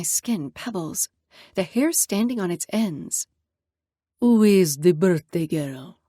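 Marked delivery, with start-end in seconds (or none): 4.2-6.0 put-on lower, slow, grim Italian accent; thick Italian accent. Italian father greeting his daughter, grim thick Italian accent. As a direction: purr it with a heavy Italian accent.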